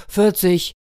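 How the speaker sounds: loud, high outburst; sudden excited blurt. A narrator's reading. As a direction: burst out with the answer. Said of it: "Da platzte es aus dem Büffel heraus".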